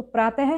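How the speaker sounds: प्रातः with "ha" at the end; visarga as h. Shown as a demonstration. Teacher repeats the correct form, visarga as h. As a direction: sound the final h in प्रातः. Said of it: "हम इसको बोलते हैं".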